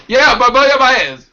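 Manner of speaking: Barboya Baez said high and loud, slowly; attempted Spanish name. Shown as a demonstration. To the co-host, trying Noelia's surname, high and loud. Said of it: "You're not quite saying it right".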